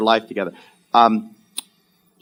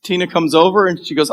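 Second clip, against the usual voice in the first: ordinary delivery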